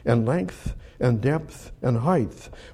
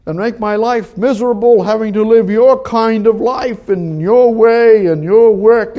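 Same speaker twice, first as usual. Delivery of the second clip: high, loud, accusing questions to God; indignant challenge; 9 semitones higher, 8 dB louder than usual. Acted. Preacher voicing a doubter confronting God, loud and high. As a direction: press God with these questions in mock protest.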